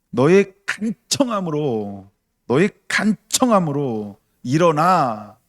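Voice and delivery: slow, loud, 너의 stretched and weighted; stress on 너의. Shown as a demonstration. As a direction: dwell on 너의 each time. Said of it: "보세요". (너의를 천천히 힘주어 강조)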